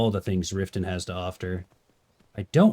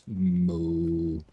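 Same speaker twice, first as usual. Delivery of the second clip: low, softer deep moo; low bass moo; 6 semitones lower, 3 dB quieter than usual. Acted. Streamer trying a deeper cow sound. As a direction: drop it into a bassy moo. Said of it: "that's got more bass".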